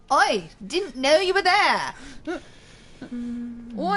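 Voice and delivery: high, loud mock Cockney; mock Cockney accent. Joking about her sister going Cockney, mock Cockney accent. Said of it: "Or Cockney accent".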